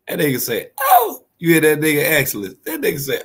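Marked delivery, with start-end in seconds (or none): 0.8-1.2 high falsetto whoop, loud; imitating his high-pitched holler; mock holler. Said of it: "He started hollering".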